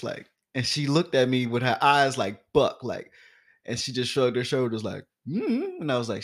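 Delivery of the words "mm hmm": much higher, dismissive mm-hmm; shrugging mm-hmm. Retold or imitated. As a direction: shrug it off like you won't help. Lady shrugs, no help.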